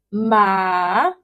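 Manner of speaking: MA long, falling then rising; third tone. Shown as a demonstration. Teacher asking students to pronounce it, dipping "ma". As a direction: stretch "ma" down and back up. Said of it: "falling and rising tone combined together".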